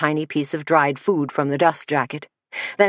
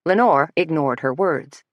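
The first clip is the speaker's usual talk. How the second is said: ordinary delivery, nothing marked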